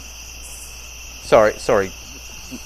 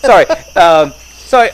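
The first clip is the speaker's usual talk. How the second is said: laughing; 8 semitones higher, 9 dB louder than usual. Real, not acted. Laughing reply after being teased.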